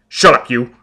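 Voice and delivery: loud, furious bark; savage shout. Acted. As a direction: snap at her to shut up. Foyle leaps at her.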